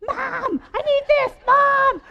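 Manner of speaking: put-on high shrieking child's yell; kid screaming Mom. Friend imitating a child yelling for mom, shrieking. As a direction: scream Mom like a demanding toddler. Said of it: "one of them goes".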